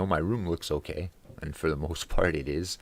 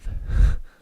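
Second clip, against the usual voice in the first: short laugh; 10 dB louder than usual. Real, not acted. Laughing mid-story about thief.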